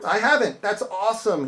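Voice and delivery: high, loud disbelief at a trivial complaint; incredulous retort. Retold or imitated. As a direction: scoff at the customer, voice climbing. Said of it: "And I'm like".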